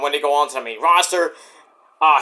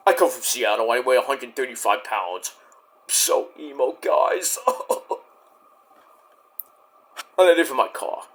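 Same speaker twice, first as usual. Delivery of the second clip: lightly voiced brooding emo, softer and lower; mock emo Punk; 6 semitones lower, 5 dB quieter than usual. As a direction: mope it out like a sad teen. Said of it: "I was talking like C M Punk".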